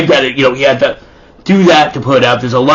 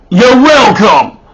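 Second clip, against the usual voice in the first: loud, drawn-out, higher voice; big man's proud reply; 6 semitones higher, 5 dB louder, slower than usual. Acted. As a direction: boom it slowly like a pleased big guy. Big man after hitting his head.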